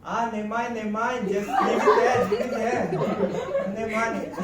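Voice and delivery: high, laughing Singlish auntie. Retold or imitated. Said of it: "the auntie was like".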